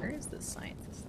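breathy whisper, barely voiced. Real, not acted. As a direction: whisper it so nobody hears. Searching in a quiet moment.

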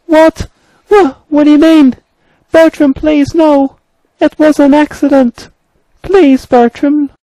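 very high, panicked plea; frightened high-pitched engine; pleading engine character. Acted. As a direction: beg in a squeaky engine voice. Main Engine pleads for life.

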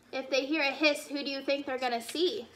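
ordinary delivery

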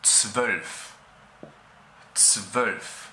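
zwölf with a short front rounded Ö; German zwölf. Shown as a demonstration. Teacher pronouncing the German word for twelve. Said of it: "En alemán se pronuncia".

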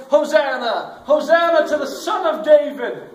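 loud, high acclaiming shout; crowd's shout. Retold or imitated. Crowd hailing Jesus as he rides in, shouting. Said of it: "they shouted".

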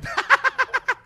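high-pitched chuckling fit; high teasing laughter; mocking laugh. Real, not acted. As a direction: laugh at your own jibe. Laughing at own old-age jibe.

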